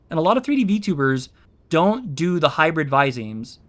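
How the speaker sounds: normal talking voice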